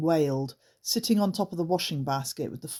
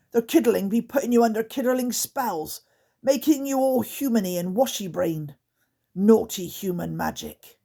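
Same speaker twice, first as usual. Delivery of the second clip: higher, louder accusing creature; scolding goblin warning the others; suspicious creaker; 6 semitones higher, 5 dB louder than usual. Acted. Grunt warns the other creakers, louder creature voice.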